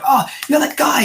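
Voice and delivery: high, excited fan recognizing a celebrity; eager fan. Retold or imitated. Fan spotting Steve Martin's character on the street, high eager voice. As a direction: gush like a starstruck stranger. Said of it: "starts going".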